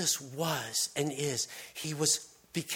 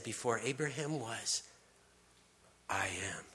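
a sacred secret, softer and lower; solemn hush; 4 semitones lower, 7 dB quieter than usual. Acted. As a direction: drop to a soft, low whisper on I am. Jesus' climactic divine claim.

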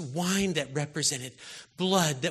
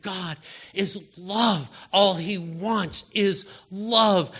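slow and louder; slow, louder sermon proclamation; 3 semitones higher, 6 dB louder, much slower than usual. Real, not acted. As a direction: proclaim each word. Preacher quotes First John.

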